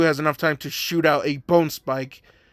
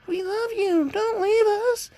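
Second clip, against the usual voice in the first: put-on children begging their papa not to leave; pleading children. The fake kids beg Papa to stay, in high pleading child voices. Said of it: "the other ones are just saying".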